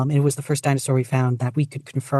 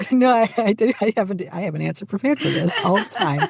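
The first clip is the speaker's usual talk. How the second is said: laughing through words; 7 semitones higher than usual. Real, not acted. Laughing at her own blank.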